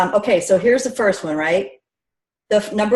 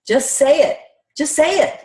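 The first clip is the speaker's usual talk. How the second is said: urging; 5 semitones higher than usual. Shown as a demonstration. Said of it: "Here's my advice".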